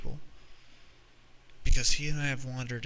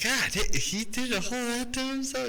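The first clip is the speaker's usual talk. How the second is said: much higher, stunned mock disbelief; incredulous; 12 semitones higher, 4 dB louder than usual. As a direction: squeak out the disbelief. Stunned reply to the relative.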